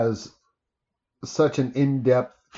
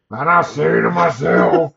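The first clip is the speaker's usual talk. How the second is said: louder, lightly sung imitating [name]; [name] impression; 5 dB louder than usual. Joking about a Potter song, mock [name] croon. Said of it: "What a wonderful".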